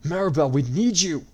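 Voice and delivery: loud calling out; shout. A narrator's reading. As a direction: call her across the battle. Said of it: "Robin shouted".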